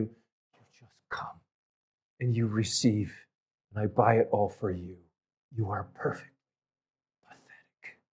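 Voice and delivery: very soft, fading almost to nothing; hushed invitation. Retold or imitated. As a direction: let God's invitation sink to a hush. God's invitation voiced to congregation.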